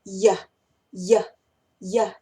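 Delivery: "ya" as the weak form of you, short "yah"; reduced you. Shown as a demonstration. Teacher showing the casual form of you, reduced "ya". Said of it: "generalmente la decimos ya".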